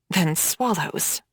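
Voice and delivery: ordinary delivery, nothing marked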